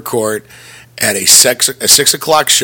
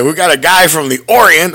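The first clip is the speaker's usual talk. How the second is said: louder, higher, eager pitchman's voice; Stan Lee pitching; 6 semitones higher, 5 dB louder than usual. Retold or imitated. Stan Lee pitching a new comic character, loud and eager. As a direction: sell the idea like a proud old comic creator. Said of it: "Stan Lee come in to, like, pitch new comic book characters".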